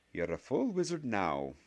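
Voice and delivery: lightly voiced Scottish schoolmistress congratulating; stern witch professor. A narrator's reading. Professor telling Harry he is a wizard, lightly prim.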